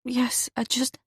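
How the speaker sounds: breaking into a whisper; hushed whisper. Acted. Eve to Marcus after the news, whispered. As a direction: barely voice the words. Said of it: "it tastes disgusting".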